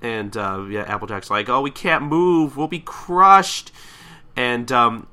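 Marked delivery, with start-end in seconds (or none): 1.5-3.7 slow, rising wail of mock dread; acted alarm. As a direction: stretch it out in hammed-up distress. Trapped pony's acted alarm.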